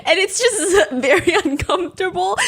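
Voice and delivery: real laughter breaking into the words; laughing. Real, not acted. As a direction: barely get it out for laughing. Cracking up describing awkward balloon humping.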